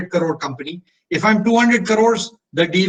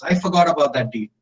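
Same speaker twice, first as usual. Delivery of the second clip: normal talking voice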